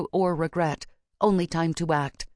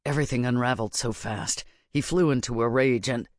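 put-on grim young witch Baz; 4 semitones lower than usual. A narrator's reading. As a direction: lower character voice, explaining.

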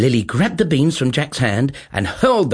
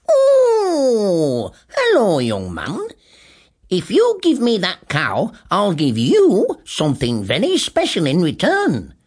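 put-on high, wavery old man's voice; a sly little old man, coaxing; strange old man; 6 semitones higher, 4 dB louder, slower than usual. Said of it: "strange little man with a huge grey beard".

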